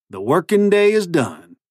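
high, drawn-out cowboy drawl; cowboy accent. A narrator's reading. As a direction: play a would-be cowboy, slow and high. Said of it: "his ridiculous new accent".

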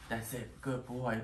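normal talking voice

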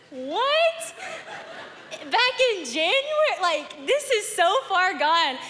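high, slowed, laughing what; laughing disbelief. Real, not acted. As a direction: laugh through the shock. Incredulous laughing reaction.

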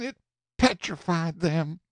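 ordinary delivery, nothing marked

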